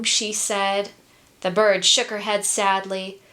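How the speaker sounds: ordinary delivery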